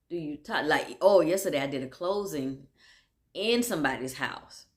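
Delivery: ordinary delivery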